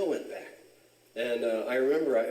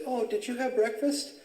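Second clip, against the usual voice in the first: imitated girl's voice, far higher pitched; Blanche; 12 semitones higher than usual. Girl checking on him the next week, high imitated concern. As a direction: put on a high, solicitous question. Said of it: "she said".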